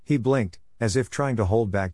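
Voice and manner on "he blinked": ordinary delivery, nothing marked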